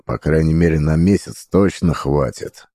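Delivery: ordinary delivery, nothing marked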